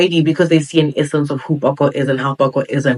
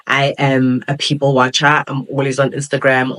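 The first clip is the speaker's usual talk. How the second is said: ordinary talk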